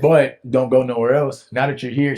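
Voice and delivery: high, loud, hammed-up ordering about; frantically pin the guest down, playfully; mock bossy host. Host telling the Lord where to stay, mock bossy. Same acted host to God.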